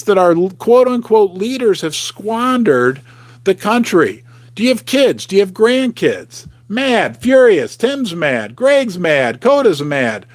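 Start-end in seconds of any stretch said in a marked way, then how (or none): none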